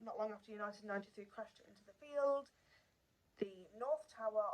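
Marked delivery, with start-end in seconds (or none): none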